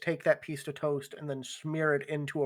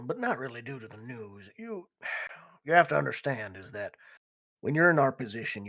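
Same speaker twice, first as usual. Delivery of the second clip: measured biker gang leader; 4 semitones lower than usual. Acted. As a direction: explain the code gravely, as a gang boss. Game master voices biker gang leader.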